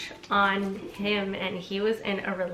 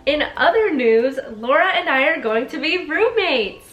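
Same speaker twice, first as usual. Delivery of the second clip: loud, high, thrilled announcement; excited reading; 6 semitones higher, 8 dB louder than usual. Real, not acted. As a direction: read it out like big news. Excitedly reading roommate news.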